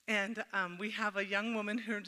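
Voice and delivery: normal talking voice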